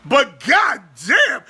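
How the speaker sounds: usual speaking voice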